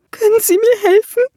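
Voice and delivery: screaming at the troll for help; desperate scream. A narrator's reading. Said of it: "schrie sie".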